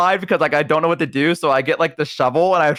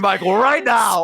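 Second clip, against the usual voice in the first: higher, drawn-out pet scolding; mock stern command; 7 semitones higher, slower than usual. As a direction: drag out the order like an exasperated owner.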